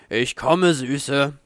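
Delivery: loud, playful call to a lover; freudiges Rufen. A narrator's reading. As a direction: call out in eager anticipation. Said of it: "rieb in Vorfreude seine Hände und rief". (freudig rufen)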